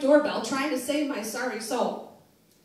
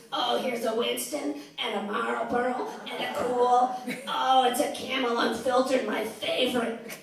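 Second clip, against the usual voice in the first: slow, lightly voiced raspy old smoker gloating over butts; a hint of a raspy old mom delighting in her find; 4 dB louder than usual. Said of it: "that really raspy smoker's voice".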